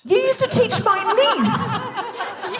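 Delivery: high, slow, laughing disbelief; astonished slow repeat. Real, not acted. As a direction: repeat it, stunned and amused.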